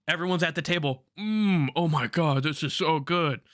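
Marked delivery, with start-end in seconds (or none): 1.2-3.4 savouring. Retold or imitated. Table savouring the meal, voiced.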